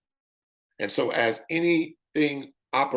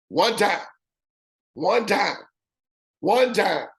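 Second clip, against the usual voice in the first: "One time" pitched much higher and hit hard three times; emphatic repeat; 8 semitones higher, slower than usual. Shown as a demonstration. Salesman stressing to viewers that the fee is paid once, high and emphatic.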